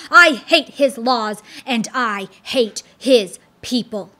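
loud snarling fiend, hitting each I hate; hateful declaration. Acted. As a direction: spit out his hatred of the king. Apollyon declaring his hatred.

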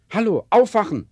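loud call to snap her out of it. Acted. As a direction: raise the voice, wake her up. Boss rouses staring colleague. (lauter Weckruf)

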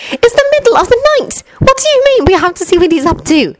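put-on high, cross schoolgirl whispering at the boys; Hermione's voice.

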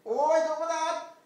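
high, loud calling across the hills. Acted. Shepherd calling loudly for the lost lamb. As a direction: shout out to find the lost lamb.

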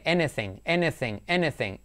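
"Anything" repeated as "E-ni-thing"; vowel demonstration. Shown as a demonstration. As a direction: start each "Anything" on a clear E. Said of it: "Y todo I como E".

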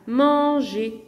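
"manger" slowly cut into man, ger; split into syllables. Shown as a demonstration. Teacher giving two-syllable verbs, "manger" broken in two. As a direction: stretch "manger" over two separate beats. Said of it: "un verbe avec deux syllabes".

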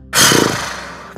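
sharp puff of breath blown out; noisy exhale. Shown as a demonstration. Meditation guide showing how to breathe out, noisy exhale. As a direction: breathe out with an audible whoosh. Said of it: "make a noise. Breathe out".

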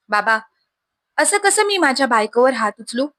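ordinary delivery

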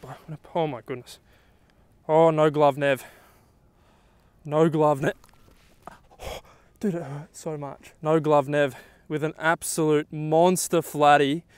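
usual speaking voice

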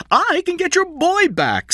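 very high, loud, drawn-out cry; shout. A narrator's reading. Score calls to King while being thrown out, shouting. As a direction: call it out desperately high. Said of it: "Score shouted".

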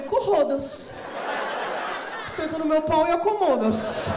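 loud, cheeky rhyming comeback; cheeky rhyme. Retold or imitated. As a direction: fire back the rude rhyme.

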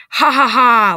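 loud, deliberate ha-ha-ha; mock villain laugh, punched out; acted creature laugh. A narrator's reading. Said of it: "laughs the creature".

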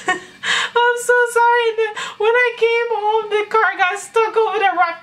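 half-laughing imitation of crying; laughing through mock sobs. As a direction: giggle while faking the tears. Laughing while mimicking her crying.

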